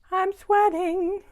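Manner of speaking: high, drawn-out mock complaint; playful singsong whine. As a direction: stretch it out high and loud, playfully. Playful repeat of complaint about heat.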